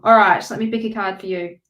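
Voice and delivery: usual speaking voice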